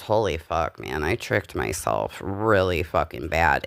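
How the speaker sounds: normal talking voice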